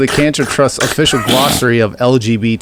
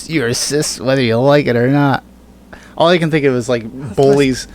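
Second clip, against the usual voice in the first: ordinary talk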